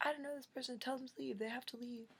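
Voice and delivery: high, loud frantic yelling; freaking out. Retold or imitated. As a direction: lose it and plead at full pitch. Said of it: "I just lost my mind and was freaking out".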